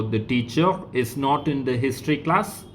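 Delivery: "the teacher is not in the history class" with a rising question tune on "class"; careful dictated question, rising at "class"; question intonation. Shown as a demonstration. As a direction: make it a question by lifting "class".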